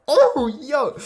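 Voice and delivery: high, loud, hammed-up whoop; exaggerated startled holler; impressed surprise. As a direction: cry out at a sudden sight. Acting villain spotting a woman.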